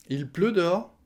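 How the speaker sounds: "Il pleut dehors?" pitched high, ending upward; rising question tune. Shown as a demonstration. Teacher showing the oral question, rising. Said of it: "Sauf qu'à l'oral, on dit".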